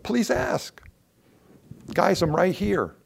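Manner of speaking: high-pitched, earnest appeal; urging plea. Acted. The Lord urging his proud people to ask, urging plea. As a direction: plead with them as if trying to get noticed.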